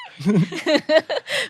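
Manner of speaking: a high burst of laughter. Real, not acted. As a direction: laugh out in delight. Laughs at the remark.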